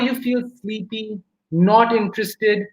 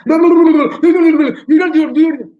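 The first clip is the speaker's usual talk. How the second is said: high, rapid-fire babble, louder; talking too fast; 7 semitones higher, 7 dB louder, much slower than usual. Shown as a demonstration. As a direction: demonstrate gabbling so fast nobody can follow. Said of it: "someone's talking too fast".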